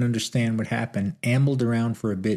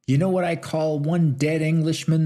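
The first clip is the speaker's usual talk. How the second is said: loud, higher roaring call to the crowd; roared; 6 semitones higher, 4 dB louder than usual. A narrator's reading. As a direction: rouse the saloon with a big bellow. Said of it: "the stranger roared".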